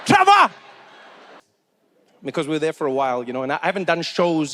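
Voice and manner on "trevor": short, loud high-pitched call; abrupt, high holler of a name; street shout. Acted. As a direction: yell a name to someone in the street. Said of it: "I hear people are just like".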